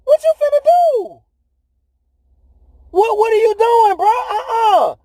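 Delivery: high, incredulous mock voice of the disciples; hammed-up high disbelieving disciples; disciples in disbelief. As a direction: squeak out the disbelief like a stunned friend. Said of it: "The disciples was like".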